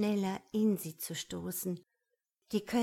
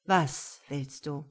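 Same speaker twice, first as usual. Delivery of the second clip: low, slow, deliberate question; demanding; 5 semitones lower, 3 dB louder, slower than usual. A narrator's reading. Man presses woman to name her wish, slow and commanding. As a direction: press for an answer, drawing it out.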